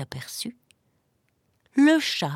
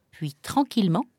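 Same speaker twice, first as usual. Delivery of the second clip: plain reading voice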